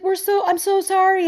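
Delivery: high, earnest apology; high sincere sorry; apologetic friend. Retold or imitated. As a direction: gush an apology. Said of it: "She's like".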